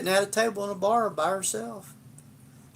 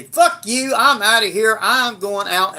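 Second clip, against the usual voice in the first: higher, louder mock fight voice, settling toward the end; retold angry outburst; 5 semitones higher, 5 dB louder than usual. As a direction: replay storming out of a fight. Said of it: "we fought passionately with one another".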